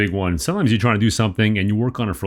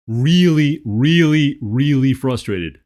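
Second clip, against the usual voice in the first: loud, emphatic venting; acted frustration; 3 semitones higher, 6 dB louder, much slower than usual. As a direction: punch out each "really", loud and higher. Voicing frustration in tapping script.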